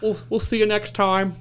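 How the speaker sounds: mocking the cops. Mocking the cops who left him alone, sing-song.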